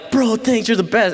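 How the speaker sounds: high, gushing retold thanks; effusive thanks. Boy thanking the friend who lied for him, effusive.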